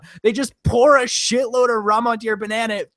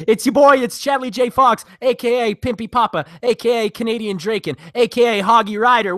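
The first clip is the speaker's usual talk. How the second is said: raised, hyped roll call of nicknames; hype intro; 4 semitones higher than usual. Acted. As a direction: announce yourself like a wrestler.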